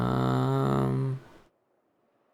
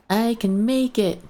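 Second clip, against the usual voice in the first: straining higher; 12 semitones higher, 4 dB louder than usual. Pretend.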